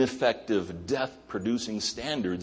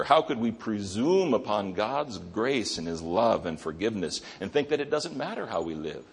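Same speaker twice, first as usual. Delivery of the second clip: usual speaking voice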